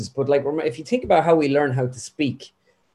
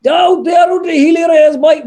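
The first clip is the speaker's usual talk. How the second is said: much higher, louder shouted Kerry caricature; Healy Rae voice; 9 dB louder than usual. Acted. Impressionist bursting into a loud high Kerry Healy Rae voice.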